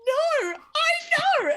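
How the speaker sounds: very high, slow, squeaky drawn-out answer; nervous reply. Retold or imitated. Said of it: "And you'd go, like".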